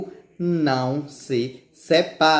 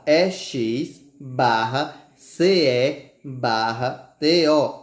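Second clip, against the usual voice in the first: slow letter-by-letter spelling; slower than usual. Shown as a demonstration. Teacher spelling exceto slowly.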